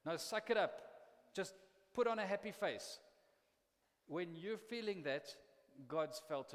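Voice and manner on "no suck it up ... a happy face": stern dismissive God. Retold or imitated. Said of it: "looks down at your frustration and says".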